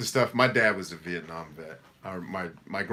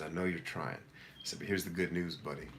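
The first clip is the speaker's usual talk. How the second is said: soft comforting; 2 semitones lower, 8 dB quieter than usual. Retold or imitated. Father comforting crying son.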